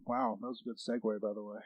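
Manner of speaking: low, soft deadpan praise; kind of sarcastic. Real, not acted. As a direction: praise it drily and quietly. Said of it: "I was kind of being sarcastic".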